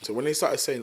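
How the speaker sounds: ordinary talk, nothing marked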